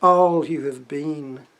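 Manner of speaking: slow, weighty declaimed verse; poetry recital. Acted. Poet begins an untitled poem for the camera, slow declaimed poetry. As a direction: hit the opening words loudly and leave long pauses.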